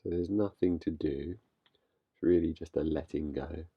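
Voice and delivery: calm, unhurried guiding voice with long pauses; guided meditation. Real, not acted. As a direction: gently lead listeners into stillness, leaving space between phrases. Leads a meditation.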